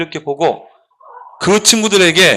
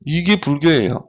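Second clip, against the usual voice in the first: ordinary delivery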